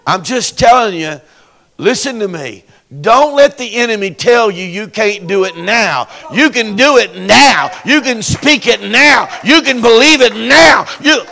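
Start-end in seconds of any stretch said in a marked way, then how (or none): 3.0-11.2 rising from firm to loud, each line louder; preacher urging the congregation, building to a shout; building preaching. Real, not acted.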